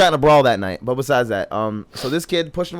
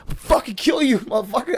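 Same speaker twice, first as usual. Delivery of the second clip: imitating a drunk threat, higher; retold yell; 9 semitones higher than usual. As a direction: replay the threat pitched up high. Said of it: "I yelled it at him".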